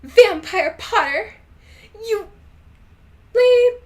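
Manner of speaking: put-on over-the-top teen scream; yell. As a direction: shriek the insult across the classroom. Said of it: "I yelled".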